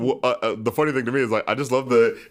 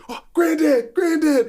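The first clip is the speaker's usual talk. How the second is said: calling out; 12 semitones higher, slower than usual. Retold or imitated. Riley calls out Granddad.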